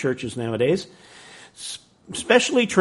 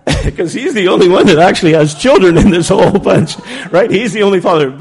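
loud, raised voice over laughter; loud punchline explanation; 4 semitones higher, 8 dB louder than usual. Real, not acted. Speaker explaining Gary's joke amid laughter, loudly. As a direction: call out the explanation of the joke, higher and much louder.

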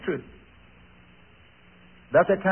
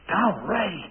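stern father; 5 dB quieter, slower than usual. Retold or imitated. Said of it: "When he changed his mood".

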